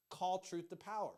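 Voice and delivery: usual speaking voice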